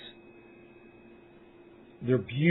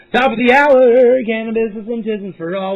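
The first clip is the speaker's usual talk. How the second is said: shouted toast; 5 semitones higher, 8 dB louder than usual. Real, not acted.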